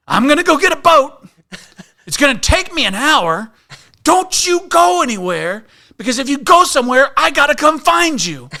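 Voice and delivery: high, loud stern command; annoyed ranger orders. Retold or imitated. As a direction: lay down the law, fed up.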